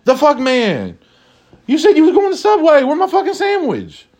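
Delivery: shrill high coworker, outraged; indignant coworker. Acted. Coworker voiced in imagined scene.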